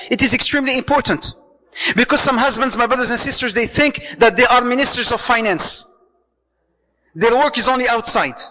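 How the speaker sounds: ordinary delivery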